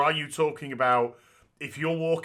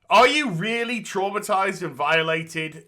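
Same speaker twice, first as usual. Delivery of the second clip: sarcastic; 5 semitones higher, 5 dB louder than usual. Retold or imitated. Mocking the claim of trauma.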